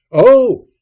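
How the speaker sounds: high, very loud cry of alarm; full-voiced startled yell. A narrator's reading. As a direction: shout it in sudden alarm. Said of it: "I cried".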